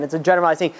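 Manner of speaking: ordinary talk, nothing marked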